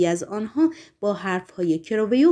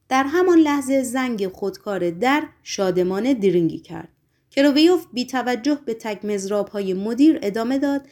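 ordinary delivery

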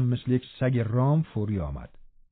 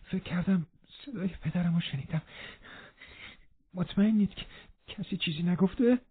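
mild panting; 7 semitones higher, 6 dB quieter than usual. Acted. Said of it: "نفس زنان گفت".

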